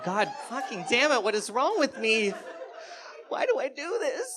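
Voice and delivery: high-pitched, exasperated self-scolding; exasperated self-reproach. Retold or imitated. Storyteller cursing himself for his answer, exasperated. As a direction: berate yourself in a rising, comic wail.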